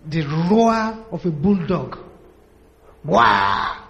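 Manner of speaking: low growling bulldog roar; mock bulldog roar at the gate; dog roar. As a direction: bark it out like a bulldog.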